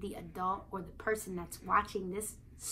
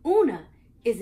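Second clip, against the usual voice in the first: Una called out much louder; ringing name announcement; 10 dB louder, faster than usual. Acted. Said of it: "Letter U already".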